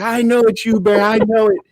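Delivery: high, loud, insistent lion voice; accusing lion. Acted. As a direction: shout the accusation as the stuck lion. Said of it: "He's like".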